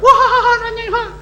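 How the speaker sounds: high, loud karaoke howl; mock karaoke wailing. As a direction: imitate someone belting out karaoke. Mimics people shrieking at karaoke.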